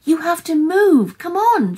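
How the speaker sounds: frantic imploring cry; desperate pleading. A narrator's reading. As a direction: beg urgently, pushing the voice up and out. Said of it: "she pleaded".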